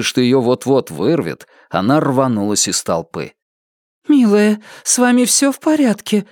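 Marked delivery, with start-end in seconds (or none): 4.1-6.3 high woman's voice, caring; solicitous high-voiced stranger; concerned, sympathetic. A narrator's reading. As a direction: ask kindly, pitched well up. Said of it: "участливо спросила какая-то женщина".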